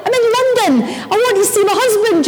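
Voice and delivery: high, urgent pleading; retold desperation. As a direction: re-enact a frantic wife's plea. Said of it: "I'm desperate by then".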